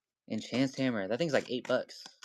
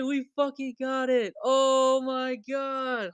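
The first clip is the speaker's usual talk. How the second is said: ecstatic screaming. Real, not acted. Streamer screaming to chat after pulling the gold card. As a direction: scream it out, can't believe the pull.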